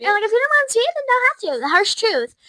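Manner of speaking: high, loud indignant rant; indignant outburst. Real, not acted. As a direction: blurt it out indignantly.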